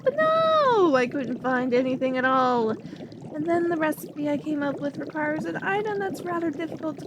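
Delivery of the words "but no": much higher, indignant burst; exasperated no. Acted. Alchemist complaining about missing recipes, indignant high burst. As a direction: throw up the hands, fed up.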